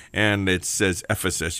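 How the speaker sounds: ordinary talk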